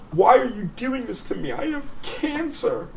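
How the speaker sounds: high, soft mock-bawling whine; imitated crying. Friend retelling a pinned pal's protest, high mock crying. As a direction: plead in a tiny tearful voice, slowing at the end. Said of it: "he's crying. He's bawling".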